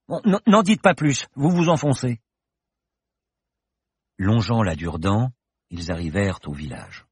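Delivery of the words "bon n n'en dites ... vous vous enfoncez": put-on exasperated young woman. Paloma cutting him off.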